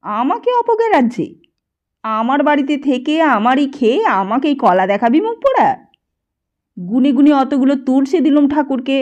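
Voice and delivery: loud, high furious yelling; shouting old man. A narrator's reading. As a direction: drive the boy out with a yell. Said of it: "চিৎকার করতে করতে বলে".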